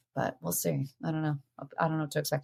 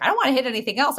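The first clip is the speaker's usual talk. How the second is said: high, worked-up retelling; retold frustration; 7 semitones higher, much faster than usual. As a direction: act out your own exasperated outburst. Acts own frustrated outburst in story.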